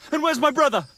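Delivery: loud, high demand; angry demand. Acted. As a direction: bark the question out. Armed man demands his brother.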